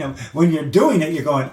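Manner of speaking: ordinary delivery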